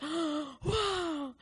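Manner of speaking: long, high, falling whoa; awestruck. Retold or imitated. Recalling how joy was birthed in her, awestruck. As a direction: draw it out in wonder.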